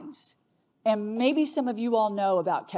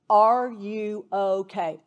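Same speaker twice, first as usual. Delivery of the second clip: gentle slow ask; 4 dB louder, much slower than usual. Shown as a demonstration. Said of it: "There is nothing threatening about saying".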